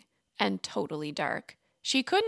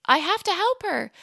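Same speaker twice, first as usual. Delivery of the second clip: urgent resolve; 11 semitones higher, 6 dB louder than usual. A narrator's reading. Maria resolves to save her.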